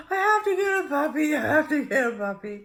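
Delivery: high, loud tearful plea; pleading through tears; desperate crying plea. Retold or imitated. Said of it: "I phoned my daughter and started crying".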